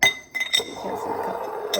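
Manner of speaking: ordinary talk, nothing marked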